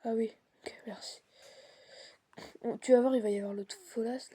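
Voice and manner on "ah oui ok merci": whispering. Real, not acted. Hushed while sneaking.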